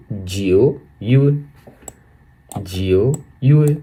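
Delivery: जीओ यू ए said slowly, each syllable apart; slow model syllables. Shown as a demonstration. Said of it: "कैसे बोलेंगे?". (जीओ यू ए धीरे धीरे)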